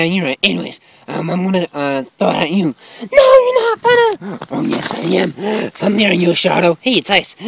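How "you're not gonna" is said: high protesting character voice. Acted. Snowball fight.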